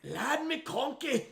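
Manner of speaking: high, drawn-out hammed-up Scottish accent. Host topping co-host's take on the name, exaggerated Scottish accent. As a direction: ham the name up in squealing Scottish. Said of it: "saying that in a Scottish accent is amazing".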